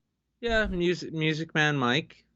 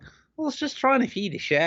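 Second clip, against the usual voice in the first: a deliberately bad Australian accent, pitched higher; mock Australian, hammed up; cheesy fake Australian voice; shitty Australian accent; 13 semitones higher than usual. Shown as a demonstration. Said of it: "you gotta give it that shitty Australian one".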